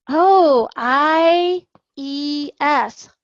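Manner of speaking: a clear, slow spelling out for a student. Shown as a demonstration. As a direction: spell each letter so a child can follow. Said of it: "spelling the ending for the student".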